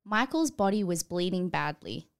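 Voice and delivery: ordinary talk, nothing marked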